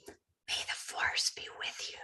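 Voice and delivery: soft, unvoiced, slow whisper; hushed whisper into the phone. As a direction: breathe it like a secret blessing. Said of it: "I kind of whispered over the phone".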